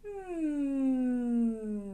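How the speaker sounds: a calm humming tone; gentle hum. Shown as a demonstration. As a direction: settle into a gentle mm. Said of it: "do a nice gentle".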